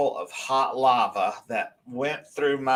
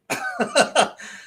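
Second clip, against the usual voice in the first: breathy laugh; 12 semitones higher than usual. Real, not acted. Laugh after the cop's line.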